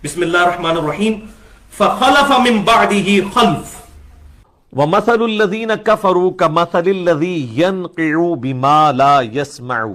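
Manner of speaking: very slow, drawn-out melodic tilawat; slow chanted Arabic recitation; Quran recitation. Acted.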